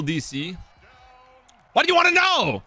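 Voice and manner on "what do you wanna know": high, loud outburst; shout. Real, not acted. Streamer reacting to the game, loud shout. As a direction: blurt it out at full volume.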